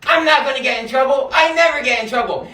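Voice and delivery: ordinary delivery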